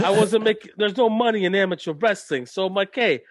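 hammed-up wise guy promo; Tony D'Angelo. Mocking a wrestler's promo about leaving amateur wrestling, hammed-up wise guy voice. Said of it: "Just another promo".

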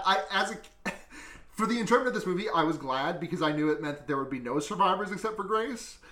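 usual speaking voice

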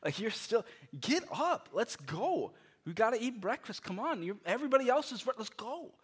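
a hint of a fed-up parent hurrying the kid; exasperated parent. Parent back after five minutes, kid still asleep, mildly exasperated. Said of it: "I'm like".

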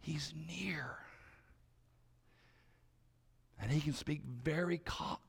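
soft, intimate hush; whispering. Shown as a demonstration. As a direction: speak as if very near. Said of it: "He whispers".

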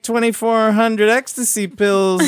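high, casual put-on voice; tentative high-voiced request to the authorities; man talking to police. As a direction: pitch it up, like a sly caller. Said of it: "he was like".

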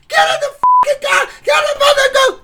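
very high screamed order; frantic shriek. Retold or imitated. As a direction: shriek at them to get in. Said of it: "And I'm like".

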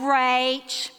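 slow, deliberate older-sister imitation; Barb. Sister explaining her speech, slow and deliberate. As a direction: stretch each word out very slowly. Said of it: "speak so slowly and talk like this".

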